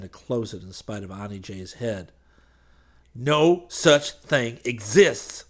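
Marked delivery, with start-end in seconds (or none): 3.1-5.4 slow, forceful declaration; shouting. A narrator's reading. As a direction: hammer each word out. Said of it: "he shouted".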